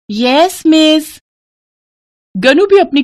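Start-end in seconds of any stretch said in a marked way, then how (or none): 0.1-1.1 put-on high, sing-song kids answering; children's chorus. A narrator's reading. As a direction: chime in like pupils.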